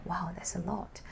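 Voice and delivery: hushed, amazed whisper; soft mock amazement whispered; whispered aside. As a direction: murmur it off to the side. Whispered aside about noodles.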